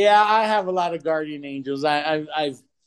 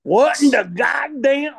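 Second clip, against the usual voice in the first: first try at southern drawl; 6 semitones higher, slower than usual. Pretend. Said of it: "wait, wait, wait".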